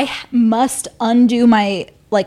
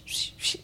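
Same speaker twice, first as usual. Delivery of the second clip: whispered swish sound effect; hushed swishy noise; swishing fabric noise; 15 dB quieter than usual. Retold or imitated. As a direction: imitate rustling fabric, very soft and voiceless. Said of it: "it was, like, the swish".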